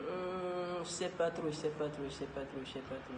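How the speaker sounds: soft, fading repeated musing; hesitant mumbling. Real, not acted. (hésitation murmurée qui s'éteint)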